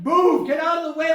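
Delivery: high, loud shove-aside command; urgent shouting. Acted. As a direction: yell to clear everyone out of the way. Acted family pushing to take photos.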